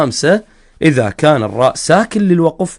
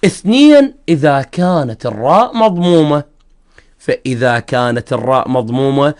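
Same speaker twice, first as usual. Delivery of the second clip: usual speaking voice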